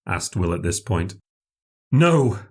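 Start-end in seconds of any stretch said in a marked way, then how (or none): 1.9-2.4 higher, forceful NO; extraordinary emphasis. A narrator's reading. Castaway refusing a boat for the lady, emphatic "No". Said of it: "with extraordinary emphasis".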